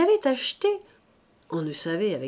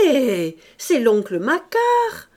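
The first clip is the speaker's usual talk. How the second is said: louder, slow call announcing the uncle; 4 semitones higher, 8 dB louder, slower than usual. A narrator's reading. Mouret to his mother-in-law, announcing the uncle, called out louder. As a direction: call it out across the steps. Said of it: "cria Mouret".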